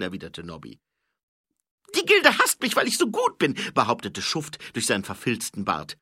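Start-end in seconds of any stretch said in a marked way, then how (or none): 2.0-3.6 very high, loud character voice; boastful petty thief. A narrator's reading. Thief bragging to the watchman, high and loud. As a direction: boast in a high, shouted squeal.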